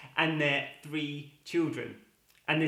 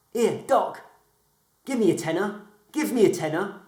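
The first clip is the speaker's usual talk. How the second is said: high, louder pleading little voice; cheeky talking knee; 5 semitones higher, 6 dB louder than usual. Acted. Talking knee nags the doctor for money, high and cheeky. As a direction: nag him for the tenner.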